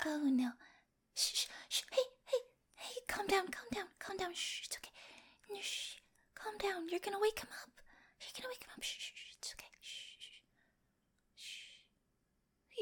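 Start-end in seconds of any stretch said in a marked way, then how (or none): 1.1-12.8 whispered, fading shushes to calm someone; urgent hushing. Acted. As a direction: hush them fast before they wake him. Hushing panicked partner, sleeper nearby.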